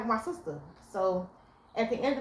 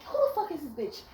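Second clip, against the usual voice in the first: high, laughing mock snap; hammed-up furious girlfriend; mock angry outburst; 7 semitones higher than usual. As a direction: play the words she held back. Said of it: "I didn't say that".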